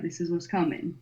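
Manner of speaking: ordinary talk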